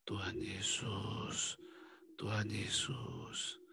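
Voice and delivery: slow, soft whisper; whispered prayer to Jesus. Shown as a demonstration. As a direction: breathe the name reverently. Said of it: "Bisikan namanya".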